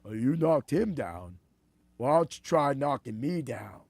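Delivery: low-pitched movie boxer impression; Sylvester Stallone. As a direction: issue a low challenge in the boxer's voice. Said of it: "Sylvester Stallone goes".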